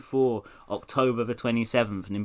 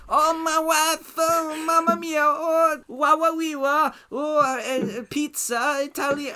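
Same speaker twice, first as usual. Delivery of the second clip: jolly Italian pizza chef, pitched way up; Borat voice; 6 dB louder than usual. Acted. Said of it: "Kazakh journalist Borat Sadyerev".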